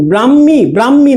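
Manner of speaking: ব্রাহ্মী said bram-mi, without any h; wrong pronunciation. Shown as a demonstration. Teacher correcting a student's pronunciation, ব্রাহ্মী mispronounced as bram-mi. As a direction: double the m in ব্রাহ্মী, drop h. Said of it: "ব্রাহ্মী না ভাইয়া".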